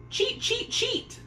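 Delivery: very high chirping bird calls; chattering sparrows. A narrator's reading. Sparrows scolding Peony, shrill high chirps. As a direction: peck out each word like an angry sparrow. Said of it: "they chattered".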